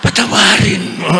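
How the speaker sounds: mock plea breaking into laughter; laughing plea. Bishop laughing as he begs the paper to forgive. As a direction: laugh through the joking plea.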